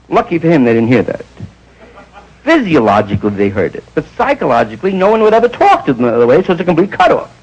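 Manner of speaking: ordinary delivery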